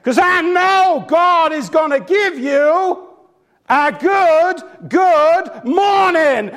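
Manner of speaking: high, slow, shouted preaching; imitated Pentecostal preacher. Minister promises the dead man a morning, preaching at full pitch. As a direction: draw each word out in a rising charismatic cry. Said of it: "that's how they speak".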